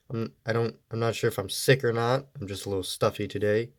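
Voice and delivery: ordinary talk, nothing marked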